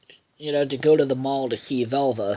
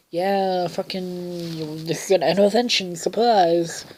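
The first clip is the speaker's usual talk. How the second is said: higher, sing-out surprise announcement; mock group announcement; 5 semitones higher than usual. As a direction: imitate relatives springing a surprise. Family announces intervention in retelling.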